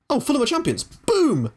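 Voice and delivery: excited. Real, not acted. Manager cheering his club's promotion, excited shout. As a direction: cheer the news out.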